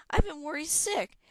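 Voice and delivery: high, loud, exasperated worry; worried scolding. A narrator's reading. Worried Hera scolds Mud.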